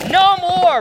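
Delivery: high, loud mock command; commanding cry. Preacher voicing Christ over the box, loud command. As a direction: declare it like a victor.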